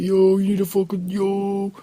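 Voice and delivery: high-pitched mock freak-out; Harry. Friend watches him vomit on the beach, freaking out. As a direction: imitate a friend losing it, voice shot upward. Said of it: "Harry was freaking out".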